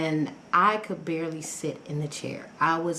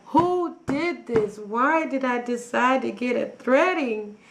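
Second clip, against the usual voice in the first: pained whine; 6 semitones higher than usual. Retold or imitated. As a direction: moan it like it really hurts. In pain while being threaded.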